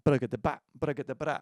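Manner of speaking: fast spoken drum syllables; rapid rhythmic "ba-da-ga-da" imitating drums; vocalized drum lick. Shown as a demonstration. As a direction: rattle off the flam rhythm with your mouth. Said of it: "if you can play 'em that fast".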